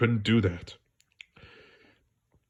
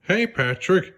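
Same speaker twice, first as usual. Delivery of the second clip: calling out; 8 semitones higher, slower than usual. Acted. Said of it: "he called".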